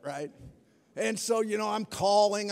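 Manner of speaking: usual speaking voice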